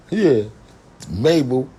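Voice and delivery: ordinary talk, nothing marked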